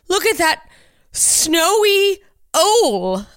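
"owl" as a long, loud OHL, high and drawn out; mock pronunciation of owl. Host mocking how the word owl should be said, "owl" drawn out as "ole". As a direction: put on a high, slow voice and stretch "owl" into "ole". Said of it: "What am I supposed to be like".

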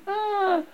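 high acted scream; thin high wail. A narrator's reading.

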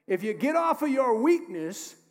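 loud, rising preacher's cry; fiery preaching. Acted. Pastor urging the church on, loud and fired up. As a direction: preach it hard to the congregation.

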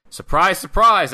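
loud, high sarcastic "surprise"; mock surprise. Host recapping yet another lock-up, mock surprise. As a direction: announce the predictable with big mock amazement.

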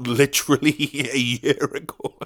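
words breaking into a laugh; amused laughing. Real, not acted. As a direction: laugh at your own delay as you say it. Laughs at the year-long delay.

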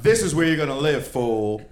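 hammed-up angry mom putting the kid in place; angry mother. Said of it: "Instant flame in her eyes".

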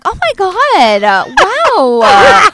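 high, slow, gushing mock mom; a hammed-up starstruck mother. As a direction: fawn over the stranger like an excited mother. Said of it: "being like".

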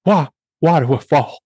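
hurried Cockney butler impression; put-on British butler, quick phrases falling away; Michael Caine. Host as Michael Caine's butler, Cockney. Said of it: "What about, like, Michael Caine?".

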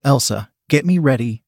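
ordinary delivery

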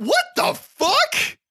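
high, slow stunned exclamation; disbelief. Real, not acted. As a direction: say it utterly stunned. Disbelief at ending.

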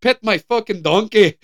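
hammed-up Scottish accent, half laughing; bad Scottish accent. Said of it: "I was gonna do a Scottish accent".

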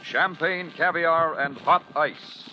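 usual speaking voice

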